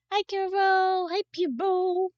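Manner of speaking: high cutesy voice, slow on the second; squeaky high greeting. Acted. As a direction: greet them in a tiny squeaky voice.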